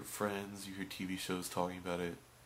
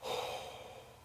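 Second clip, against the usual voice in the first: long voiceless exhale; weary drawn-out sigh; heavy sigh; 3 dB quieter than usual. Acted. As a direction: let out a long, defeated breath. Sigh after hearing the order.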